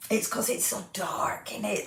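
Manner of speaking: hushed whisper about the dark. Real, not acted. Host whispering to viewers about the dark. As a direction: drop to a whisper.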